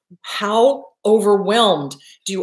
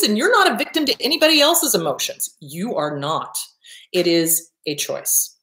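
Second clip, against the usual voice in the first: ordinary talk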